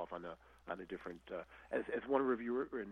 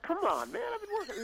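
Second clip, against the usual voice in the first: much higher, pleading whine; squeaky defensive retort; high whiny protest; 5 dB louder than usual. Retold or imitated. Protesting friend's teasing.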